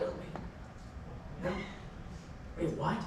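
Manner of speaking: hushed, bewildered murmur; confused whisper. Retold or imitated. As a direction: whisper it, suddenly lost. Retold moment of confused disorientation.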